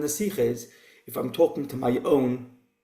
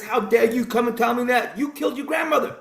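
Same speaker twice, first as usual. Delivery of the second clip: mock outrage; 8 semitones higher than usual. Retold joke, shliach accuses.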